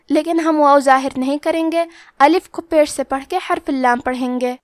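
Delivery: ordinary talk, nothing marked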